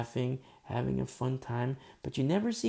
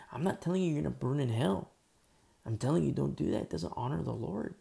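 usual speaking voice

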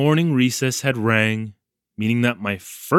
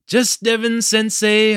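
mildly exasperated correction, pitched higher; sighed reply; 9 semitones higher, 4 dB louder than usual. A narrator's reading. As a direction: correct them with a touch of a sigh. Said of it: "I sighed".

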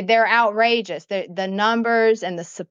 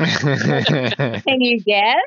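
very slow, low Southern drawl with laughter; hammed-up Southern accent; 5 semitones lower, much slower than usual. Teasing friends who asked where she's from, hammed-up Southern accent.

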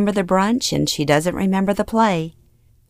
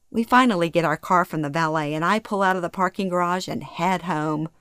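ordinary delivery, nothing marked

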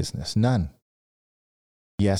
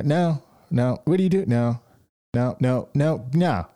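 imitating a curt refusal; 4 semitones higher than usual. Retold business owners refusing.

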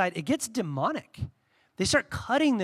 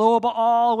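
mock prophets calling; 3 semitones higher, 6 dB louder than usual.

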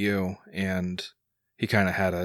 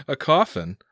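higher, through the tail of a laugh; amused disbelief; 7 semitones higher, 3 dB louder than usual. Acted. Son laughs at dad waking in a coffin, amused. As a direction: laughingly echo it as a question.

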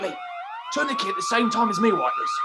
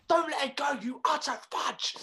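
usual speaking voice

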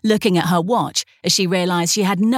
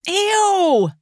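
high disgusted ew; 13 semitones higher than usual. A narrator's reading. Charlie complains as water splashes her feet, high-pitched. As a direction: squeal of disgust at wet feet. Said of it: "Charlie complained".

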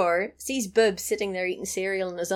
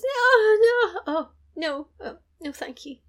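mock wailing; 10 semitones higher than usual. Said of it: "she goes".

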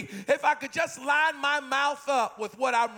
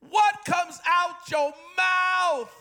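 higher, slow, drawn-out call; emphatic preaching; 5 semitones higher, 4 dB louder, much slower than usual. Real, not acted. Preacher pressing the congregation, slow emphatic call. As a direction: lean hard on every word.

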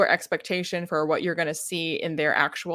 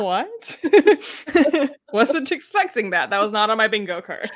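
high, laughing fake shock; mock surprise; 5 semitones higher, 6 dB louder than usual. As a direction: act caught off guard, then laugh it off. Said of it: "you're like".

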